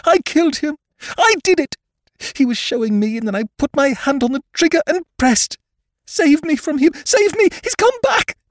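loud, very high, frantic shrieking; woman in full hysterics; hysterical confession. Acted. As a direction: scream it out in terror, building to desperate pleas. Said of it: "she cried hysterically".

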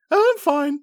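high halfling voice, brushing it off; muttering halfling. A narrator's reading. Halfling muttering to Carrick after being rescued. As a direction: mutter that you're fine, sniffling. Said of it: "Loris mutters".

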